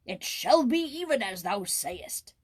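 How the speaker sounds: slow, grand king voice; kingly mock solemnity; royal decree. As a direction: pronounce the verdict. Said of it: "exclaimed the king".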